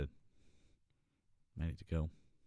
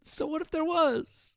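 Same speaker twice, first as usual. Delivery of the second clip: mock rant; 14 semitones higher, 4 dB louder than usual. Repeats the ranted line mockingly.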